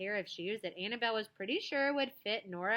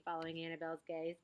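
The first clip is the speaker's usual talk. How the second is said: ordinary delivery, nothing marked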